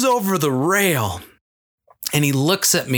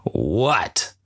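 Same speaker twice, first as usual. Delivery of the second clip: irritated; 7 semitones lower than usual. Retold or imitated. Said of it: "Kinda like".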